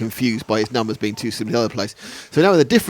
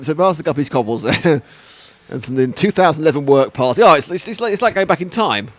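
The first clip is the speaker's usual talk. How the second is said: usual speaking voice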